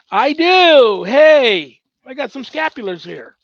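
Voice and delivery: high, loud delighted exclamation; excited find. Real, not acted. Finding the scapulars he thought were gone, excited. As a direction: burst out happily at finding them.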